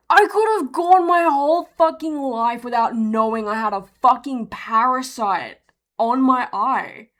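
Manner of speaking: loud, exasperated rant; outraged disbelief. Real, not acted. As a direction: start high and loud, drawing out the end. Ranting in disbelief about parasite.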